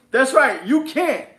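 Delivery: loud, high mock agreement; gloating taunt. Investor to the cat with no live feed, gloating. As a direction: crow it loudly after a laugh.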